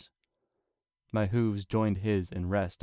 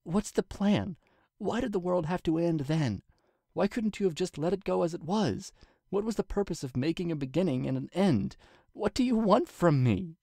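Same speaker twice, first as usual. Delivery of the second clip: much higher, mounting desperation; Twilight's desperate questions; 10 semitones higher than usual. A narrator's reading. Pacing Twilight presses the silent king, much higher. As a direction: demand answers while pacing.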